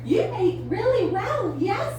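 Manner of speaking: high sing-song praise. Real, not acted. Praising bird Lucy.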